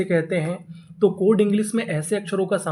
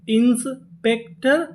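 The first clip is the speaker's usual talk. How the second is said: slow, higher inspector with a rising end; slow sounding out; 4 semitones higher, much slower than usual. Shown as a demonstration. Teacher slowly building the word for students. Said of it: "तो पूरी meaning".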